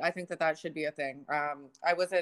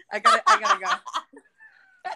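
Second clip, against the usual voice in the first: high, slow, loud words broken by laughter; laughing through it; 9 dB louder, slower than usual. Pretend. Joking about fake date excuses, laughing hard and high. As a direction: crack up while trying to finish the excuse.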